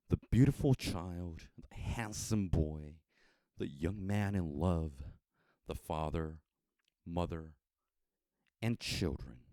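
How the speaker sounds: ordinary delivery, nothing marked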